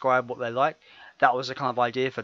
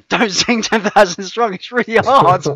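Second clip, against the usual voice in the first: higher, louder mock Barlow voice, slow; [name]; 8 semitones higher, 9 dB louder than usual. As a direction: ham up the singer's advice. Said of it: "[name] even gave me some advice".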